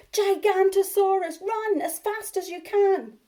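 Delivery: high, loud panicked cry; Bonehead's last false alarm, high and loud. Acted. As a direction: cry out as if fleeing. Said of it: "the cry began".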